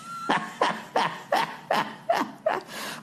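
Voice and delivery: a high, airless wheeze of a laugh; breathless laugh. Real, not acted. Comic laughing at his own microchip joke. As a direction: crack up at his own line.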